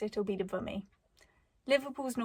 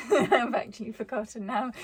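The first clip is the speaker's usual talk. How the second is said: laughing it off, louder; 2 semitones higher, 8 dB louder than usual. Real, not acted. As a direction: laugh at losing the thread. Lost her train of thought.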